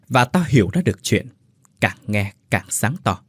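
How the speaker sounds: plain reading voice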